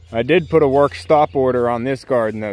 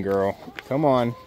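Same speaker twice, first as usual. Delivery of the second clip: usual speaking voice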